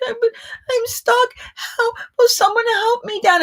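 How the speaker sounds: high, whimpering cry for help; near tears. Retold or imitated. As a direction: whine like a child stuck on a bench. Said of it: "on the verge of tears".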